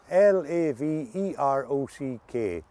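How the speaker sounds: slowly spelling out letters. Shown as a demonstration. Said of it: "I'll spell it to you".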